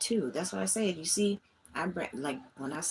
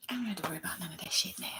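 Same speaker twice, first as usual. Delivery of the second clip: fast, breathy whisper on the edge of tears; choked-up whispered aside; tearful whisper; 3 semitones higher, 4 dB quieter, much faster than usual. Real, not acted. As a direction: let it slip out crying under your breath. Emotional venting, later near tears.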